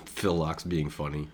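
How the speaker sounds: breaking into laughter before speaking; real laughing. Real, not acted. Poker player amused by Phil Locke being funny, laughing. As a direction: laugh first, then settle into the remark. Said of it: "Forgive me for laughing".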